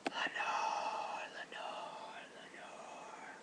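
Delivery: whispered echo dying away to nothing; fading echo. A narrator's reading. Darkness echoing the name back to the narrator, fading whisper. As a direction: let each repeat fade further off like an echo. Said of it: "an echo murmured back the word".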